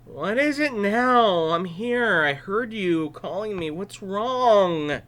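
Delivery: put-on drowsy boy woken again; sleepy yawning boy. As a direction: yawn through the words. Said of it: "said with a great yawn".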